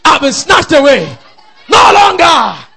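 high, loud preaching cry; exultant shout. Real, not acted. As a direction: proclaim it loudly in triumph. Preacher's declaration builds to shouting.

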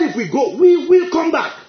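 "we will" stretched, high and loud; slow down and punch "we will" with certainty; insistent retold vow, "will" driven hard; adamant. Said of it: "He was adamant".

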